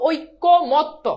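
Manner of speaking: "ঐকমত্য" slow, syllable by syllable, as oi-ko-mot-to; ভাঙা উচ্চারণ. Shown as a demonstration. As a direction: sound out "ঐকমত্য" with a final to. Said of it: "এটার উচ্চারণটা হচ্ছে". ("ঐকমত্য" ধীরে ভেঙে, শেষে ত্ত)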